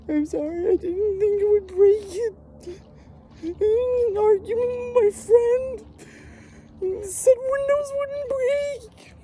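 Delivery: put-on high, slow whimpering child voice; whiny little kid. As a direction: squeak it out like a scared nine-year-old. Kid apologizing to angry mom.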